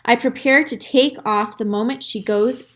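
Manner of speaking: ordinary delivery, nothing marked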